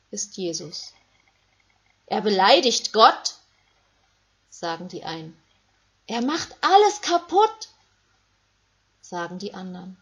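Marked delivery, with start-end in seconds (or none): 2.1-3.4 loud accusing cry; an outraged bystander shouting; angry crowd shout. Acted. 6.1-7.7 high, loud outraged cry; an angry bystander screaming at Jesus; shrill angry shout. Acted. Crowd accuses Jesus.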